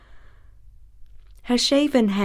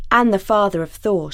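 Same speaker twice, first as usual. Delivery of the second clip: ordinary delivery, nothing marked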